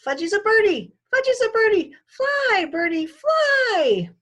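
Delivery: put-on high, loud toddler squeal, stretched slow at the end; little kid pretending to fly, shouting; excited toddler. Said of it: "Fudgy flapped his arms around".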